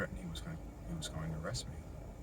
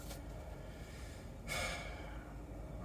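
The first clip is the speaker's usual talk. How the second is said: long weary sigh; 14 dB quieter than usual. Real, not acted. End of a weary story.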